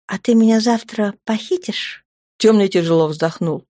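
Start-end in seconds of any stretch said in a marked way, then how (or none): 0.1-2.0 higher, eagerly hopeful question; anticipation. A narrator's reading. Woman asks the Dark one about tomorrow, with anticipation. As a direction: ask with mild, expectant excitement. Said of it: "тут же спросила с предвкушением".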